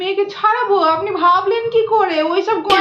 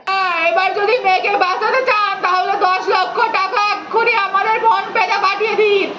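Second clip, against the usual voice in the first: ordinary talk, nothing marked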